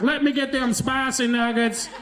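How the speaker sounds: mild put-on Puyallup voice to hide; fake local accent. Disguising himself from the cashier in a put-on Puyallup voice. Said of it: "pretended like I was from Puyallup".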